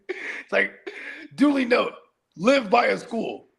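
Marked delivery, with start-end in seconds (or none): 1.4-3.5 mock resolution. Mock resolution after seeing map.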